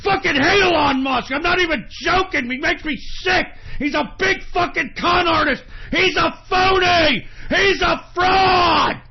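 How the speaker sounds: loud, high, slowing to hammered last words; real angry shouting, last words dragged out; furious shout. Real, not acted. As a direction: spit each insult out in real anger. Renewed rage at Elon Musk.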